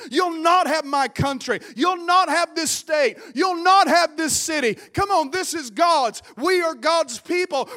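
loud, rising declarations, punching not; full-force preaching. Real, not acted. Preacher rebukes the storm, building to a shout.

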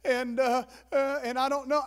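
high, hammed-up wail of dread; mock tearful fretting; retold fearful whimper. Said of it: "fearful of this".

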